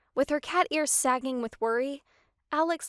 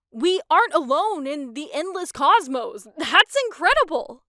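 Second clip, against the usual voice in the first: loud, high awed excitement; loud and high with wonder; thrilled; 5 semitones higher, 8 dB louder than usual. A narrator's reading. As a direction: marvel at the cosmos. Luke thrilled about aliens.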